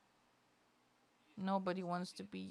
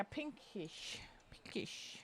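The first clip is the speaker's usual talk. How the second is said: breathy whispered musing while choosing a colour; hushed private whisper; whispered to herself; 7 dB quieter, much slower than usual. Real, not acted. Picking a paint colour, muttering in a whisper. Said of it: "whispering to herself while painting".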